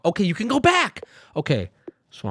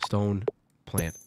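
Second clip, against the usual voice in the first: ordinary delivery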